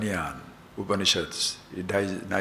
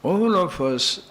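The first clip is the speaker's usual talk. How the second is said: raised voice; 9 semitones higher, 7 dB louder than usual. Real, not acted. Building sermon point.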